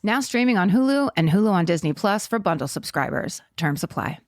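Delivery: upbeat sponsor read. Acted. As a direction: sell it like a radio spot. Reads sponsor ad.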